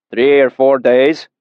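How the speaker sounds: slow, offhand answer; gruff old patron. A narrator's reading. Weathered man answering without lifting his eyes, slow and offhand. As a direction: mumble it without looking up.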